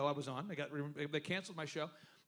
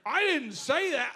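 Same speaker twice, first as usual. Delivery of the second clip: laughing denial; 7 semitones higher, 8 dB louder, slower than usual. Retold or imitated. His own reply, laughing.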